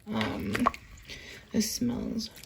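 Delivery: soft whispered aside; whispering. Real, not acted. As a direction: whisper it close to the mic. Whispered aside over the soup.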